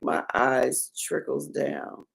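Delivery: slow, soft quote of a weeper; subdued, slow mock lament; imitated tearful lament. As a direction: draw it out quietly, as if crying. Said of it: "I'm just crying".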